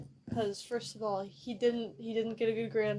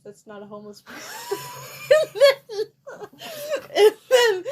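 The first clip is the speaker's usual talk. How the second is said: laughing through the words; barely holding back laughter; 13 semitones higher, 13 dB louder than usual. Real, not acted. Laughing while quoting.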